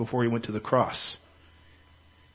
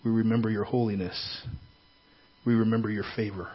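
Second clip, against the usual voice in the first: soft, earnest prayer voice; 4 dB quieter than usual. Acted. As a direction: pray quietly and earnestly. Leads a prayer.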